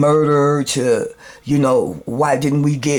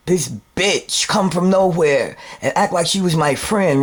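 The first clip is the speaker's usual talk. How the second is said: ordinary delivery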